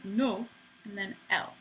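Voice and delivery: "no", then "L", as two distinct syllables; vowels pronounced separately. Shown as a demonstration. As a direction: split Noël into "no" and "L". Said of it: "So it goes".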